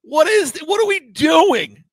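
retold exasperated outburst; incredulous, laughing-till-crying wail. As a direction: wail it in helpless disbelief. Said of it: "I was crying ... going".

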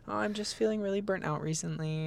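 small, high, softened voice; quiet reply. Retold or imitated. Daughter brushing off mom's check-in, quiet and high. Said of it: "I was, like, quiet".

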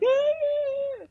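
high held wail, imitating agitated energy; mock shrieking. Imitating an angry wife's energy, high mock wail. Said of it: "When her energy is all like".

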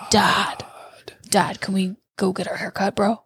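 exaggerated teen bro, half-whispered. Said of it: "we do exaggerate it".

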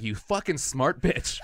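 slow, loud, high retold curse; grudging admiration. Man retelling reaction to stepmom, slow emphatic grudging outburst. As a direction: draw it out loudly, half impressed.

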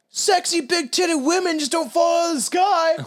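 high, loud movie-quote shout. Retold or imitated. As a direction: yell it like Jay from Dogma. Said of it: "Jay's like".

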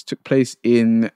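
sounding out a place name; slowly sounding out each syllable; slow careful reading. Real, not acted. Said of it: "I don't know how I'm gonna say this".

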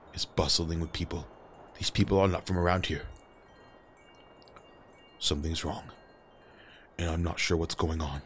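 put-on low, hushed old master warning; quiet urgent master. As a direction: warn him quietly, urgently. GM voicing Master Silk urgently.

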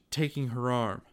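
usual speaking voice

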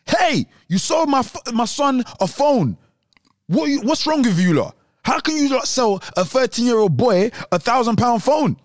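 high, scolding mum voice; angry mum. Retold or imitated. Angry mum confronting the shop.

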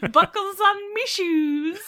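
slow Irish leprechaun voice, laughing; laughing through a slow Irish leprechaun line. Pretend. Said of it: "putting on the Irish".

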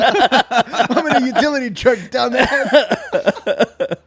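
laughing. Retold or imitated. Laughing quote.

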